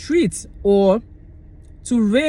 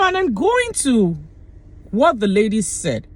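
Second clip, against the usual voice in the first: ordinary talk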